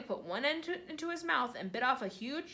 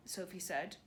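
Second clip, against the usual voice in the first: plain reading voice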